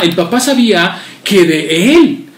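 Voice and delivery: ordinary talk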